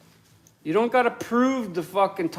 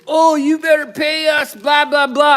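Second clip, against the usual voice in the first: mocking the hospital; 8 semitones higher, 8 dB louder than usual. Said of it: "Them fucking bitches".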